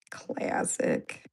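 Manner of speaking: breathless whisper after a laugh. Real, not acted. Whispers after gross joke.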